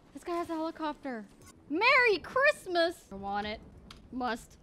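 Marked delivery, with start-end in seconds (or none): 1.7-3.0 slow, stretched, higher delighted cry; drawn-out happy exclamation; gleeful. Real, not acted. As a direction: crow over the prize. Finds a helicopter to steal.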